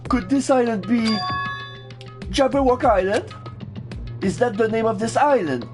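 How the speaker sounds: high, wondering anime voice; high character voice. Acted. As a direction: raise the pitch, curious and unsure. Voicing game dialogue.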